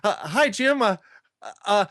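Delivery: sheepish attendee trying to ask a question; awkward laughing greeting. Retold or imitated. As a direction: fumble the greeting, nervous and high. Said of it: "kinda laughing and going".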